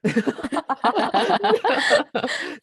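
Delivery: high, loud real laugh at the joke; burst of laughter. Real, not acted. Friend jokes bonjour, she bursts out.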